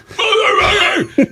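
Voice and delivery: imitated high, loud scream of rage; mock enraged howl; furious yell. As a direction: scream wordlessly like someone stung and livid. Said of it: "he's so mad".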